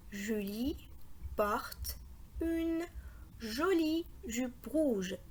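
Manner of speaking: short pauses between words, joining the last ones; measured beginner's reading pace; slow broken reading. Shown as a demonstration. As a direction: read haltingly, then link the final phrase.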